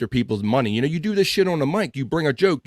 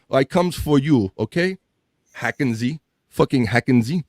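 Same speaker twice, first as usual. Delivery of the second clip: usual speaking voice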